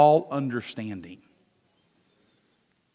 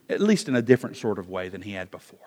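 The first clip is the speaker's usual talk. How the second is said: whispered aside; 6 semitones lower, 8 dB quieter, much faster than usual. Real, not acted.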